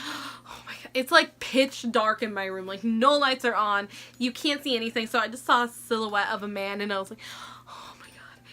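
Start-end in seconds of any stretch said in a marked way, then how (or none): 0.1-0.9 breathed out, no voice at all; barely-there whisper so the intruder won't hear; terrified whisper. Retold or imitated. Hiding in bed from an intruder, a terrified whisper. Intruder in her dark room. 7.7-8.4 voiceless hush, scared to be heard; frightened whisper. Retold or imitated. Frozen in bed in the dark, a frightened whisper. Trying so hard not to say anything.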